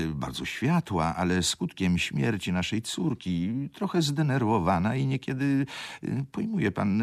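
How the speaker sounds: embarrassed husband fumbling for words; lightly voiced dithering baron, fading out; flustered baron. Baron excuses his wife, hesitant.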